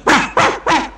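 sharp, high dog barks; three loud imitated barks; barking. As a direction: bark like an angry dog. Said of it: "looked at the bulldog and went".

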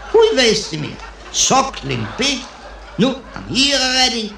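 high, lightly hammed-up disbelief; comic high-pitched dismay; mock astonishment. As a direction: repeat the dish as a high, incredulous question. Diner reacts to the waiter's dish.

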